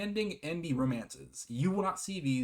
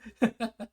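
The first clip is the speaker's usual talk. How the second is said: short high giggle, a bit louder; embarrassed giggle; 9 semitones higher, 5 dB louder than usual. Real, not acted. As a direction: giggle it off after the slip. Laughing after misreading a line.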